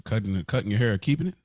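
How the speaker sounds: ordinary talk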